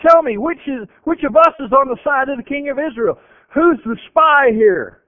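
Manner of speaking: the king of Aram, furious, rounding on his officers; angry king. Acted. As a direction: interrogate your own officers in a rage. Enraged king questions his officers.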